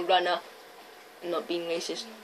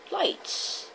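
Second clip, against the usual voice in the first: imitated whisper; 7 semitones higher, 12 dB quieter than usual. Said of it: "And my sister's like".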